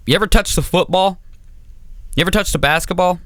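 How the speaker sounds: high, fast mock dad taunts; imitating angry dad. Dad taunting his son about sports, high mock interrogation. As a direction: jab the questions like a mocking father. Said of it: "my dad when he's like".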